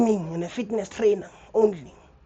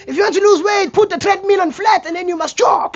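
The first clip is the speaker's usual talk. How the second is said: much higher, louder bossy advice; gym guy advice; 13 semitones higher, 8 dB louder than usual. Retold or imitated. Gym regular ordering him to jog, high and loud.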